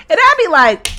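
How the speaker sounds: ordinary delivery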